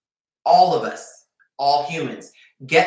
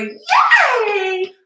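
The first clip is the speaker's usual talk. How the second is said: sarcastic shouted yay, much higher and louder; mock loud cheer; 8 dB louder than usual. Mocking a woman thrilled by scraps, loud sarcastic cheer. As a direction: cheer it like a tiny win is huge.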